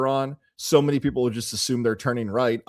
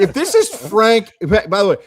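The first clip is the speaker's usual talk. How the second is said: excited aside; 8 semitones higher, 7 dB louder than usual. Real, not acted. Host excitedly calling out to listener Frank, high and loud. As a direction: lift it, eager shout-out.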